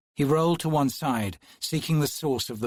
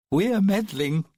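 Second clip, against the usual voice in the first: high playful twin; 8 semitones higher, 5 dB louder than usual. A narrator's reading. Twin sister voiced.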